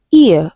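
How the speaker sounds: isolated, emphatic "ear" diphthong; diphthong demonstration. Shown as a demonstration. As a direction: sound out "ear" loudly as an ee-uh glide. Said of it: "Same with option B".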